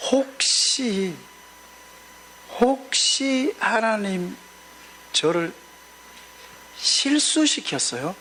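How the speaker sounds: slow, halting plea with long pauses; anguished prayer. Retold or imitated. Pastor retelling his desperate prayer to God, slow halting plea. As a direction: ask God haltingly, each word dragged out.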